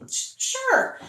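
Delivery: stammered, squeaky yes; a nervous little yes from the friend; hesitant high sure. Retold or imitated. Friend accepting the party invite, stammering high.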